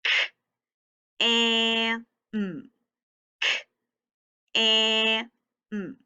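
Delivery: K, A, M as separate sounds with gaps; teacher segmenting K, A, M sound by sound. Shown as a demonstration. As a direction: hold the A, keep K and M apart. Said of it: "Let's tap it out".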